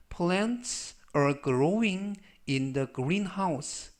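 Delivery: slow model sentence. Shown as a demonstration. Teacher reading the example sentence aloud, very slow. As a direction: Keep your belongings in the locker read very slowly. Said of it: "slow model reading of sentence".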